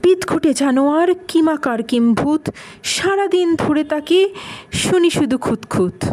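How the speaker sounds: lilting, rhythmic verse reading; poem recitation. Acted. Telling of the weird beast Kimbhut, in lilting recitation. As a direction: recite with lifted, sing-song intonation.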